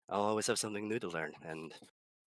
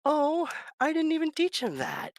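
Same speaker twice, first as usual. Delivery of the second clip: much higher, louder astonished cry; amazed delight; 9 dB louder than usual. Retold or imitated. DM thrilled his player knew goblin. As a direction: squeal it in disbelief. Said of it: "And I'm like".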